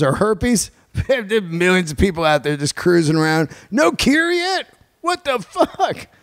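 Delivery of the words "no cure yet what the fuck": much higher, disbelieving; can't believe there's no cure; high-pitched disbelief; incredulous. Real, not acted. Incredulous outburst.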